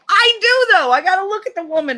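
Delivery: high, loud, rushed objection; mock protest. Protesting to boyfriend about facing his mother, loud. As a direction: burst out in exaggerated panic.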